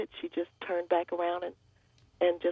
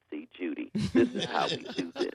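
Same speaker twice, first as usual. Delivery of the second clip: Michael Clarke Duncan; 10 semitones lower than usual. Retold or imitated. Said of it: "you know, with his voice".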